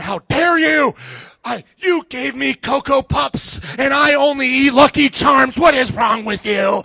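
loud, hammed-up outrage; mock scolding, pushed high and loud; indignant mother. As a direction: imitate an angry mom getting in someone's face. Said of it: "some mother in my face".

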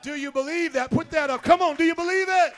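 loud, rising call to the congregation; rousing preacher's shout. Real, not acted. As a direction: build to a fast, high shout demanding a response.

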